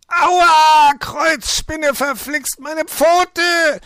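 very high, loud yelp of pain; pained scream. A narrator's reading. Fox with paw caught in the vice, screaming. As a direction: scream and curse as if your paw is crushed. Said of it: "schrie er mit schmerzverzerrtem Gesicht".

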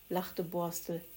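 ordinary delivery, nothing marked

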